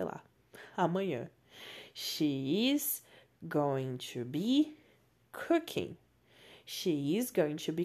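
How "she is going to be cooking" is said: slow model sentence. Shown as a demonstration. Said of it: "ela estará cozinhando".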